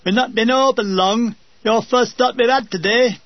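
lightly voiced dim room orderly; dopey orderly greeting the men. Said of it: "said a dopey room orderly".

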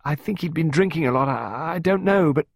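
high, loud, halting outpouring; desperate confession. A narrator's reading. Pippa confiding in Clarissa about Oliver, desperate. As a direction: force out the story in distress. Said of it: "sounded even more desperate".

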